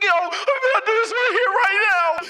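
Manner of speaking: loud, high-pitched frantic yell; hammed-up hysterical shout; mock crying outburst. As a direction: crash out at full volume. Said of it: "Bertolt starts crying".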